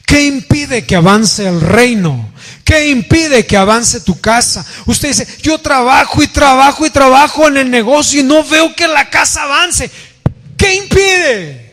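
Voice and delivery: high, insistent preacher's delivery; full-force preaching. Real, not acted. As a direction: preach it urgently, pitched well above talk. (predicación enérgica, con voz alta y aguda)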